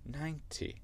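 high, soft British ninety with a T; British accent. Shown as a demonstration. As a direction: say ninety the British way, nine-tee. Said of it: "au lieu de dire un T".

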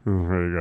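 a reluctant, low Mm, pretty good; grudging pretty good. Shown as a demonstration. Showing a co-host the three-rating version, grudging. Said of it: "If you say it like that".

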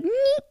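high squeaky "nyeh" sneeze tail; imitated sneeze ending. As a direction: squeak out a little nasal "nyeh". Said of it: "that end part of".

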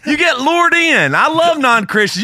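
laughing yell. Pretend. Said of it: "in that mode of yelling".

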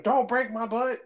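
high-pitched pretend panic; mock screaming plea. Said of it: "would I start screaming".

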